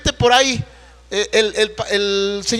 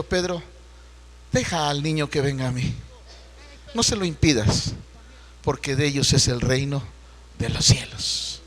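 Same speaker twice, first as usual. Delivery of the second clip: soft loving words; 7 semitones lower, 7 dB quieter than usual. Retold or imitated. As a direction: gently invite the child to come. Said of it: "suaves, amorosas". (voz suave y amorosa de Jesús)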